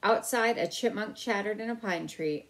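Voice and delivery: ordinary delivery, nothing marked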